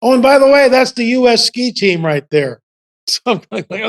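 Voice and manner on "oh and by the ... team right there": high, loud call-out in imitation; imitated parting shout, pitched up; calling over shoulder. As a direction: toss it back loudly to someone behind. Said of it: "he calls out over his shoulder".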